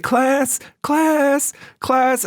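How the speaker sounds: high, pleading little teacher voice; a timid teacher's high, futile call to the class; helpless teacher. Retold or imitated. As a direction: call for order, weak and ignored. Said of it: "she'd just be like".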